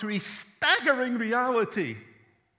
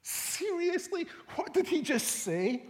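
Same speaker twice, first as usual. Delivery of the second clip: astonished crowd; 7 semitones higher, 5 dB quieter than usual. Retold or imitated. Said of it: "would be saying to one another".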